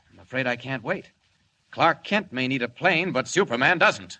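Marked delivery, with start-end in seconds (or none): 1.7-4.2 loud, ringing hero voice; heroic declaration. Acted. Clark Kent, alone, announces his leap out the window in a loud, heroic voice. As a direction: proclaim it boldly as you leap.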